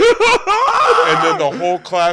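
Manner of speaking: loud laughing shout, slowed; shouting through the laughter; roaring with laughter while telling it. Real, not acted. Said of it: "scream laughing".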